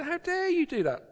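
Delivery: high, a touch indignant; imitating an affronted father; hint of fatherly outrage; mock outraged father. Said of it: "would have been outrageously angry and said".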